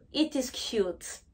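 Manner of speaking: ordinary talk, nothing marked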